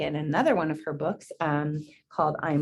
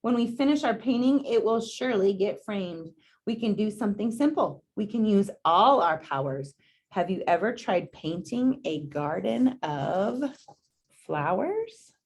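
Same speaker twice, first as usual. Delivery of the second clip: gentle, unhurried storybook read; reading to children; 3 semitones higher than usual. Acted. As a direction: read it out to a class of kids. Reads picture book to class.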